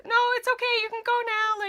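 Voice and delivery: high, loud, over-sweet brush-off; fake reassurance. Clerk making lies to get him out of the store, high-pitched fake reassurance. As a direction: imitate yourself lying in a high, loud voice. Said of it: "making lies".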